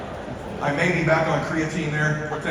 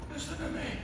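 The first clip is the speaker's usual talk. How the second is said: high, very soft plea; dying dad demanding his son listen; frail father; 14 semitones higher, 12 dB quieter than usual. Retold or imitated. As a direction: press it gently but urgently.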